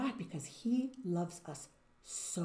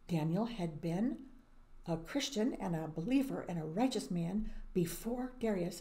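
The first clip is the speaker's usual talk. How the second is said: normal talking voice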